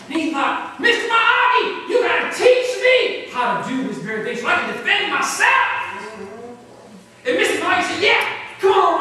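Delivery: loud, ringing storytelling from the pulpit; high, loud sermon narration; preaching at full force. Acted. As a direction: preach the story out to the whole church. Preacher retells a movie story.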